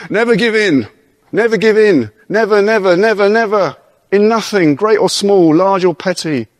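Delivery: put-on rally them like Churchill's speech to the Harrow boys; Churchill impression. Preacher voicing Churchill's Harrow speech to the church, rousing British oratory. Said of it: "I'll do the accent".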